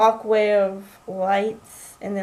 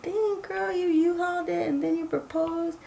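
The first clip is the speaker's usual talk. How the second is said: teasing friends; 7 semitones higher, 7 dB quieter than usual. Retold or imitated. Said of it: "people are like".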